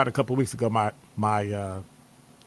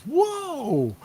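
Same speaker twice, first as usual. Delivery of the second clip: mock whoa. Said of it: "her brain goes".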